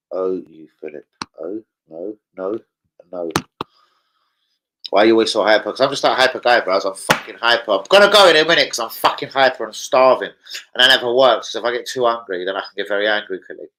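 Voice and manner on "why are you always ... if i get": loud, buzzing, can't sit still; hyper and loud. Real, not acted. Mate announces he's wired and starving, loud and hyper. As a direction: blast it out like you're wired.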